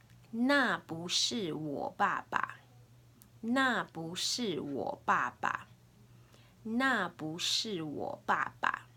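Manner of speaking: slow, deliberate Mandarin for learners; teacher's drawn-out demonstration; slow language model. Shown as a demonstration. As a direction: model each word slowly and distinctly. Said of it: "I'll say it three times".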